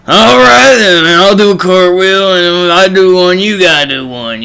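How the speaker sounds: loud, slurred imitation of a drunk man; drunken mumble. Drunk man agrees to do a cartwheel, loud mumbling voice. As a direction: start high and loud, then sag into a drunken mumble. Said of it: "mumble talk 'cause he's drunk".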